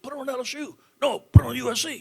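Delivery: a hint of the old man bossing his son; dad telling the boy to change the channel, mock stern; imitating daddy. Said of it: "My daddy said".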